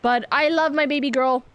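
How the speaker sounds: normal talking voice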